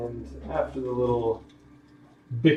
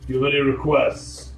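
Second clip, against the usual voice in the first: acted call from up front, a bit louder; man yelling; 2 semitones higher, 7 dB louder than usual. Driver calling back to the passengers, raised voice. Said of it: "you hear a man yell".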